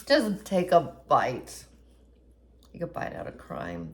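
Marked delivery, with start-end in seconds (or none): 2.7-3.9 deep low slogan voice; McGruff the Crime Dog. Retold or imitated. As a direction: drop low like an old ad. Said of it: "McGruff the Crime Dog".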